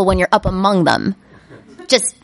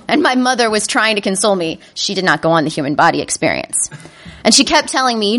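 ordinary delivery

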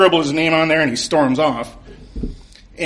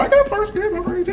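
much higher, laughing and eager; giddy excitement. Retold or imitated. Fan in line clutching Farscape, giddy and much higher. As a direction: bounce with glee.

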